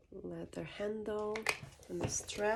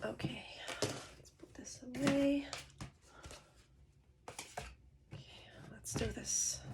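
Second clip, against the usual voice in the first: soft unvoiced whisper; 4 semitones higher, 5 dB quieter than usual. Real, not acted. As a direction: whisper close to the mic. Quiet unboxing whisper.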